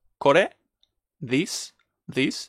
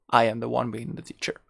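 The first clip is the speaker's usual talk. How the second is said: normal talking voice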